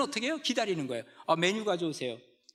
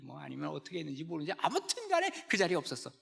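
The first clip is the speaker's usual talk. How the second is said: ordinary delivery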